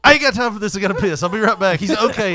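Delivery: impatient brush-off. Retold or imitated. Dad desperate for the bathroom.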